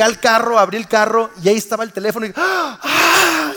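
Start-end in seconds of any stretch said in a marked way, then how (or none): 2.3-3.5 big high-pitched gasp of relief; mock relief sigh on finding the lost phone; loud relieved sigh, high. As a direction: blow out the relief loudly. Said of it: "suspiré así".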